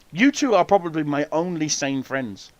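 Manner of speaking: usual speaking voice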